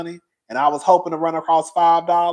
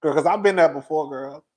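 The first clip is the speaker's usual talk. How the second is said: ordinary delivery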